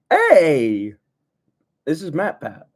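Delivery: loud excited hey; excited call. Real, not acted. Recognizing a YouTuber in the book, loud excited. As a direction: call out in delight.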